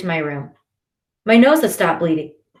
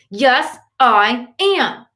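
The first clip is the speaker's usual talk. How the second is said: slow, higher, louder retort; hitting back, firm; 5 semitones higher, 4 dB louder, much slower than usual. A narrator's reading. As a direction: fire it back slowly. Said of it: "Shirzad hits back".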